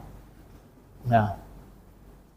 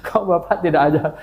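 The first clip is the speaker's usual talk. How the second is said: ordinary talk, nothing marked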